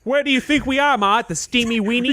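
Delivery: high, mock East Coast kid to Ma; badly faked East Coast complaint; East Coast accent. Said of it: "we're terrible at this accent".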